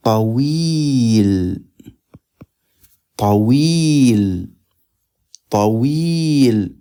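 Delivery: طويل said three times, clearly and deliberately; repeated model word. Shown as a demonstration. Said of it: "Tall is".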